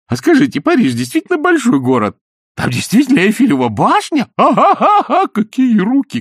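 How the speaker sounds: high, loud mocking flattery; teasing gush. Изнуренков teasing the queen with high flattery. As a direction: needle her with gushing compliments. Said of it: "вызывающе острить".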